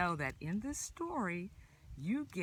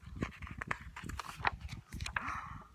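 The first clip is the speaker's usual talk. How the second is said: gasp; 7 dB quieter than usual. Acted. Surprise at next food.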